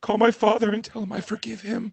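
high, frail melodrama; hammed-up last words; mock dying wish. Husband imitates wife giving last wishes, high mock-tragic voice.

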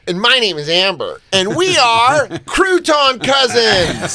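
high, singsong mock presenter with a slow big reveal; corny wedding-speech pitch. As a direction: ham it up like a scripted duo's introduction. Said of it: "They always have corny wedding speech energy".

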